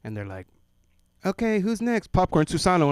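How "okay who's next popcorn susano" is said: higher, louder classroom teacher voice; teacher calling on kids. Retold or imitated. Teacher calling on the next reader, higher and louder. Teacher calls popcorn reading.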